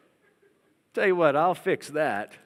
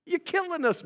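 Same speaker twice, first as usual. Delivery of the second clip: high whiny complaint; complaining Israelites; 11 semitones higher than usual. Retold or imitated. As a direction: whine at Moses in desperation. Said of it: "They said".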